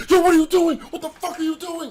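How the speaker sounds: high, loud mock shout of an alarmed teacher; retold teacher yell. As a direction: bark it like a teacher catching you. Teacher bursting in yelling.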